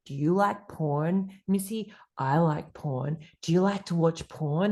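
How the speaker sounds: low, slow creepy caller; deep put-on voice. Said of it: "a very deep put on voice".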